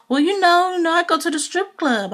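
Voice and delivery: imitating Peter. Peter voiced.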